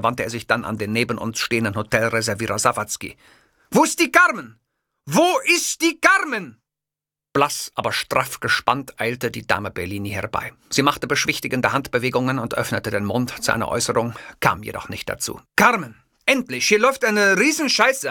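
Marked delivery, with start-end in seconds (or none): 3.7-4.6 high, loud furious call for Carmen; shouting demand. Acted. Enraged host yelling for Carmen. 5.0-6.6 high, drawn-out repeated shout; slow insistent yell. Acted. Enraged host repeating his demand slowly. 15.6-18.1 high, loud outraged ranting; furious rant. Acted. Angry host ranting at his producer Carmen.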